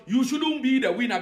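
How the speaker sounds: ordinary delivery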